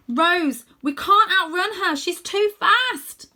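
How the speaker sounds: high, loud fearful cry, slowing at the end; boy shouting his fear to Rose; panicked shout. Acted. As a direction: sound scared they'll be caught. Boy fears the dragon.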